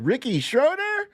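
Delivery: high questioning guess; mock-hopeful question; quizzical guess. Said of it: "She said".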